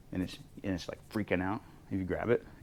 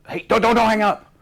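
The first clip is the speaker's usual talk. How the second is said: much higher, loud, frantic plea; panicked caller; 14 semitones higher, 14 dB louder than usual. Retold or imitated. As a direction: beg him not to hang up. Caller begging not to hang up.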